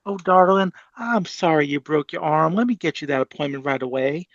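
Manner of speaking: sweet-talking Southern accent, playing the caring AI. AI agent comforting a caller in a Southern accent. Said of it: "as this Bostonian tries a Southern accent".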